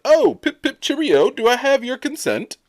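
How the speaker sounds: very high-pitched, badly faked British accent; Tom Holland's English accent. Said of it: "in his English accent".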